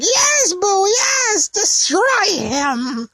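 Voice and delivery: high, cackling little wizard cheering on his monster; gleeful Babidi. Retold or imitated. Babidi cheering Buu on to destroy Kai, high and gleeful. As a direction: squeal it out like a tiny gloating sorcerer. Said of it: "And Babidi's like".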